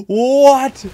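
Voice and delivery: high, loud stunned what; shocked at the screen; disbelieving what. Real, not acted. Caster stunned by the replay bug, high what. Reacting to the glitch.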